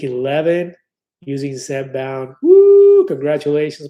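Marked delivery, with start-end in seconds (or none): 2.4-3.1 high, loud whoop; cheer. Real, not acted. Host cheering a viewer's weight loss, loud whoop. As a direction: whoop for their success.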